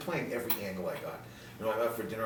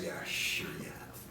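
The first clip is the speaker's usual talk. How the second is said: put-on sceptical girl; 6 dB quieter, slower than usual. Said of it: "what's your answer?".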